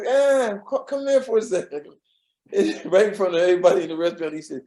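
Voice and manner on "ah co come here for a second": higher, lively comic summons; Jonathan Winters voice. Retold or imitated. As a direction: call him over like a playful comedian.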